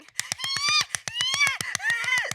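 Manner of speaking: high barking seal laugh. Real, not acted. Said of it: "like a fucking seal".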